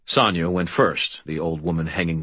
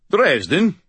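calling out; 12 semitones higher, 5 dB louder than usual. Acted. Knight calls down from ladder.

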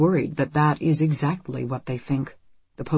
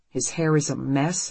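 usual speaking voice

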